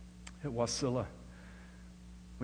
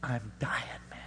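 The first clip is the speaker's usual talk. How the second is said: broken whisper; 5 dB quieter than usual. Retold or imitated. His own broken reply retold.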